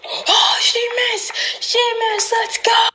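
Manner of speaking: high whispered cheering; whisper-yell the win; excited whisper yell. Real, not acted. Winning at the game.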